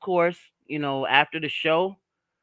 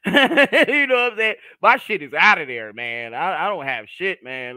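hoarse voice; 5 semitones higher, 6 dB louder than usual. Real, not acted. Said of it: "My voice is gone".

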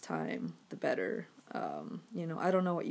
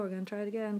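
normal talking voice

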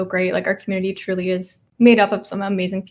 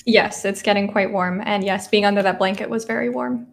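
normal talking voice

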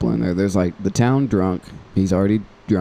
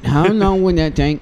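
put-on town drunk; 9 semitones higher, 4 dB louder than usual. Drunk voiced in play.

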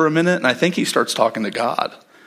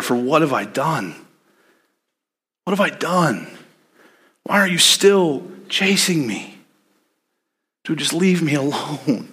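tired David. Retold or imitated. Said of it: "You can hear it in David's voice".

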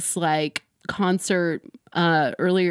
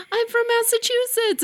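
high, excited yell from the crowd; fan shouting answer. Retold or imitated. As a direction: shout your state at the singer.

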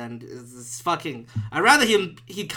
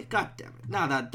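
ordinary talk, nothing marked

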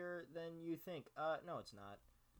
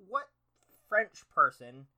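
usual speaking voice

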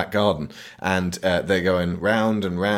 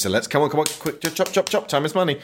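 higher-pitched mock impatience; hurrying along; 7 semitones higher, faster than usual. Filmmakers rushing to the plane sequence, hurrying. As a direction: chivvy everyone on with quick repeated urgings.

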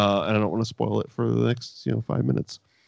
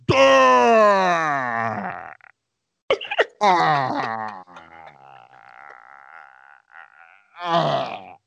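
wordless stuck groan, repeated after long gaps; dumbfounded "duh"; 3 semitones lower, much slower than usual. Retold or imitated. Taz has no answer to the put-down, imitated drawn-out dumb groan. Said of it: "his response was".

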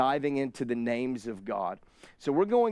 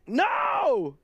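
high, sharp no; child's yell; 9 semitones higher than usual. Retold or imitated. Child in back seat yelling no at dad.

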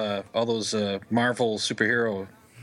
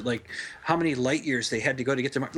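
usual speaking voice

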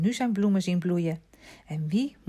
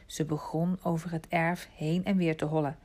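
ordinary delivery, nothing marked

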